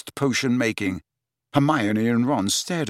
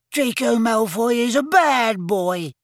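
much higher, slow, indignant squeak; angry squeaky elf; 11 semitones higher, slower than usual. Acted. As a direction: squeal it out in outrage. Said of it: "squeaked Dobby angrily".

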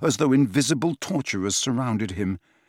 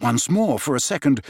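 usual speaking voice

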